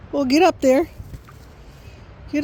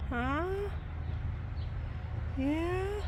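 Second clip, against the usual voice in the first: soft pet talk; 15 dB quieter, slower than usual. Real, not acted. Soft aside to dog.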